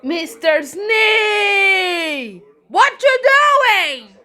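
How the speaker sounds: high, slow shout of surprise; startled shout. Pretend. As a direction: call out his name in shock. Child voices startled character calling.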